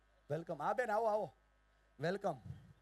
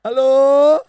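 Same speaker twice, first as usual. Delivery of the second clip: high, booming attention call; loud calling out; 10 semitones higher, 25 dB louder, much slower than usual. Real, not acted. Speaker calls the hall to attention, loud calling out. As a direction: holler it across the hall.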